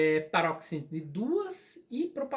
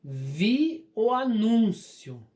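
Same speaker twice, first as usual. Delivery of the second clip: "Vi o anúncio" slow and loud, NÚN held; word-by-word dictation of "Vi o anúncio"; slow example sentence; 6 dB louder, much slower than usual. Shown as a demonstration. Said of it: "só dando a dica aqui".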